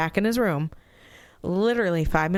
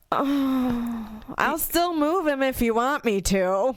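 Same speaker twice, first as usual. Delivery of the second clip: soothing; 8 semitones higher, slower than usual. Retold or imitated. Mother consoling son, retold.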